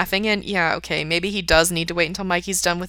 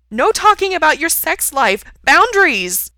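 high, loud outraged cry; shouted protest; 10 semitones higher, 9 dB louder than usual. A narrator's reading. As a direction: shout it high, demanding they stop. Gerard loudly objects to sex talk.

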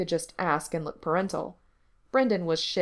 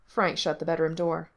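ordinary delivery, nothing marked

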